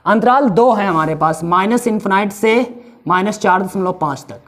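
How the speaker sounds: usual speaking voice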